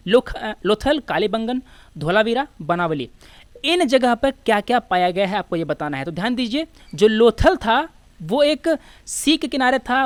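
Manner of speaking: usual speaking voice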